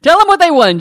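loud, high showman call; game show host. Acted. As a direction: belt it like a TV host. Game show catchphrase, called out.

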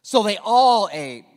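slow, loud, every word leaned on; big preacher's declaration; emphatic proclamation. Shown as a demonstration. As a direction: proclaim it to the room. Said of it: "Say they all ate".

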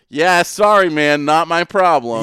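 lightly hammed-up excuse; mock brush-off. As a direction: play an awkward shrug-off to acquaintances. Imagines brushing off the store employees.

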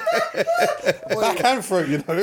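drawn-out laughing words. Real, not acted. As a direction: stretch it while laughing. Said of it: "repeating while laughing".

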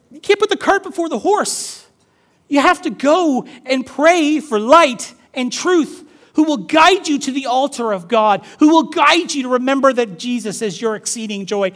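high, loud preaching voice; impassioned preaching. Acted. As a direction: preach it forcefully, driving each phrase home. Preaching at full force.

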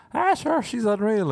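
gushing. Retold or imitated. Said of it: "you're like".

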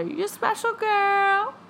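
high, cooing singsong; playful high-pitched parent voice; doting parent. Acted. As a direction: coo it high, as to a baby. Parent's bedtime words, acted out.

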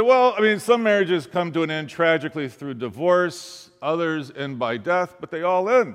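high, loud, lively delivery of the punchline; animated retelling. Retold or imitated. Pastor retelling his blunt answer to the groom, loud and high. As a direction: sell the joke with rising energy.

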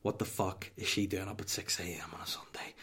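whispered private exasperation; inner voice. Retold or imitated. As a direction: whisper it as a hidden thought. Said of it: "But in my head going".

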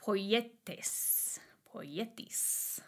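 soft, careful attempt at a Greek accent. Shown as a demonstration. Teacher showing viewers a Greek word, tentative Greek accent. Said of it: "in my best Greek accent".

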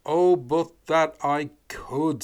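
slow, loud pooka voice; drawn-out loud lament. Acted. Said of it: "said the pooka".

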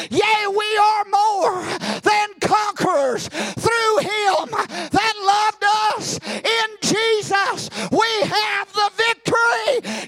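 high, loud, shouted exhortation; rousing revival preaching, mostly shouted; preaching at full force. Acted. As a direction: drive it home to the congregation.

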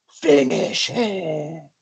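low, slow, drawn-out command; fighting game announcer. Acted. Comic capping her spoof fighting game, low announcer voice. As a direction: intone it deep and slow like an arcade fight announcer.

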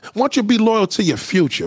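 ordinary delivery

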